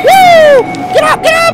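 high panicked yelling; panicked shout. Real, not acted. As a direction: yell in sudden panic. Jump scare, yelling at cat.

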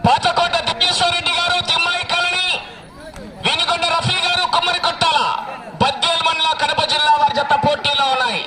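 sustained, even-pitched announcing chant; race announcer. Real, not acted. Announcer gives the crowd round time and team names, formal call. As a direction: call out the results over the ground.

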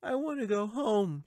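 put-on high, whiny little-girl plea; whining. A narrator's reading. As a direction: whine it like a tired child wanting home. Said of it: "she whined".

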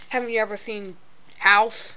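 ordinary delivery

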